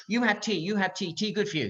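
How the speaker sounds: high-pitched, insistent broken English; imitating Iraqi guards. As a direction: mimic guards pressing tea on a prisoner. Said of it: "Then you hear the Iraqis going".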